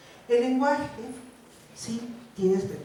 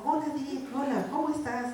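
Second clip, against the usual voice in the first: higher, lilting sweet hello; newlywed greeting; 6 semitones higher than usual. Acted. Newlywed greeting a spouse in a joke, sweetly. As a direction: greet your new spouse sweetly, pitched up. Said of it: "recién casados".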